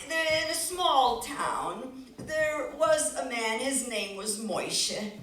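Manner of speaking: slow, drawn-out storyteller voice; slow dramatic storybook reading; story narration. A narrator's reading. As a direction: paint the village slowly. Storyteller's slow scene setting.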